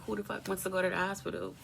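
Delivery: usual speaking voice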